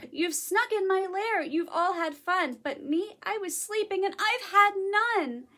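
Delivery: acted indignant protest; upset bear. A narrator's reading. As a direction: wail it like a left-out storybook bear. Upset bear scolds the party guests.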